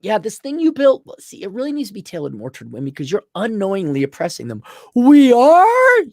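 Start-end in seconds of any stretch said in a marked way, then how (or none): 4.9-6.0 high, loud incredulous question; mock surprise. Men hearing they oppress women, loud mock disbelief. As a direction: feign shock at the accusation.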